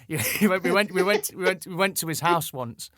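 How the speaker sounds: put-on halting stab at a Geordie accent; mock Geordie, restarting through laughter; imitated Geordie accent. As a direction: try on your guest's Geordie accent. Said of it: "do your accent".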